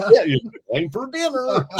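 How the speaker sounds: high, silly dog voice. Pretend. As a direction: voice the pet's thoughts playfully. Voicing the dog's thoughts.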